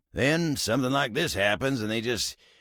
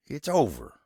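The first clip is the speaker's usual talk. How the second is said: usual speaking voice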